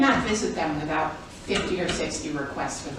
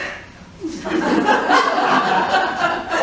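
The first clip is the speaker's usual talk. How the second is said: bright laugh; 9 semitones higher, 6 dB louder than usual. Real, not acted. Laughing at herself.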